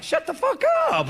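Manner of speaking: very high, delighted disbelief; friendly cussing. Shown as a demonstration. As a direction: squeal it as a friendly, amazed exclamation. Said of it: "Like, friendly cussing".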